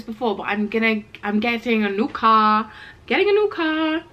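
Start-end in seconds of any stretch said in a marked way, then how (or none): 3.1-4.0 high, gleeful sing-out; excited. Real, not acted. Vlogger announcing her new car to viewers, excited. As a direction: celebrate the news in a raised pitch. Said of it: "which I'm so excited for".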